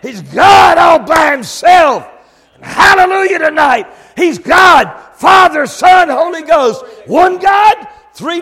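very high, very loud pulpit shout; full-force preaching. Real, not acted. Preacher proclaiming one God to congregation, full-force preaching. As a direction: shout it out in praise at the sermon's peak. Climax of the sermon.

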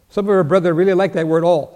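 normal talking voice

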